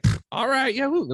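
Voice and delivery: high and giddy; excited teens. Retold or imitated. Girls thrilled to eat the brownie, giddy and high. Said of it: "They're like".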